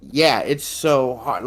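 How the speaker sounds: ordinary talk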